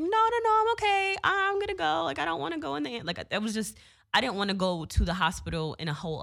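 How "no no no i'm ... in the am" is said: high frantic protest that speeds up at the end; pleading refusal. Retold or imitated. Refusing to ride in the ambulance, high and frantic. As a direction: beg your way out of the ambulance.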